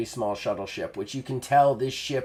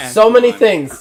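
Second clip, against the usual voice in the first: laughing; 10 semitones higher, 12 dB louder than usual. Real, not acted. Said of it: "game master laughing at a twenty".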